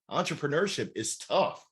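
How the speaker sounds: ordinary delivery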